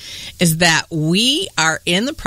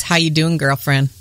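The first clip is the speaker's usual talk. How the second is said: normal talking voice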